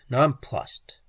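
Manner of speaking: plain reading voice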